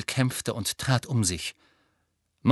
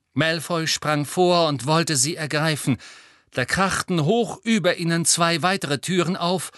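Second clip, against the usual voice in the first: ordinary delivery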